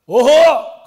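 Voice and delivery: loud, high mock scold. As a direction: imitate Dad sharply telling off the dog. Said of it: "그리고 혼을 내십니다". (크게 혼내는 아빠 흉내)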